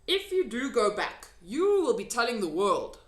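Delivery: lightly voiced gentle giant; big friendly giant. A narrator's reading. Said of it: "said the B F G".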